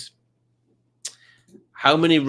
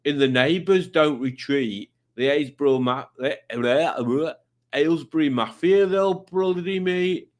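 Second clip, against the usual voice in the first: stumbling, hammed-up imitation of a commenter's accent; Tommy's accent; 4 semitones higher than usual. Host reading Tommy's rhyme in Tommy's badly faked accent. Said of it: "I should be doing this in his accent".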